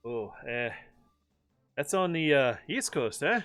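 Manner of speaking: lightly put-on Alberta accent; bad Canadian impression. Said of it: "bad impression time".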